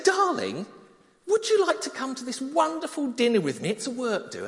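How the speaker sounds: high, loud cheery invitation; a light, eager darling voice; husband inviting wife to the dinner, eager. Retold or imitated.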